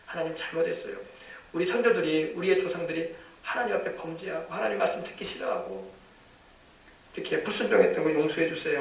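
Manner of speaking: quiet, humble plea; soft prayer. Shown as a demonstration. Daniel confessing his forefathers' sins, quietly. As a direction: pray it softly, repentant. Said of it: "하면서 기도를 하는 것입니다".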